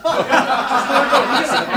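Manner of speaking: loud burst of laughter. Acted. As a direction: burst out laughing at what was heard. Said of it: "Μη γελάτε". (δυνατό γέλιο)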